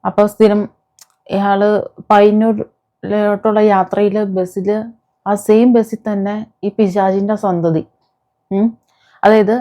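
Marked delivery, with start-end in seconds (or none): none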